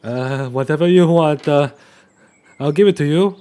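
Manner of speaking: high, loud desperate offer; frightened pleading. Acted. Riolus begging Snips to let him go, high and frightened. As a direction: beg for your life.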